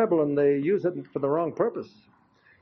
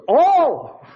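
one word, yelled high and loud; man yelling the answer back at the preacher; 10 semitones higher, 8 dB louder than usual. Retold or imitated. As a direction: shout the single word at him. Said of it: "he yelled it at me".

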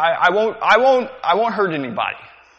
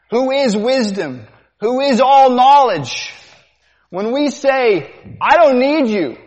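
louder, rising preacher's call; preaching at full force; 6 semitones higher, 7 dB louder than usual. Acted. Preacher pressing the congregation, loud and rising. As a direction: press the question home to the congregation.

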